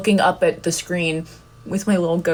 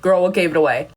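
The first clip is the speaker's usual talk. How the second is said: sassy comeback; faster than usual. Retold or imitated. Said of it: "I'm like".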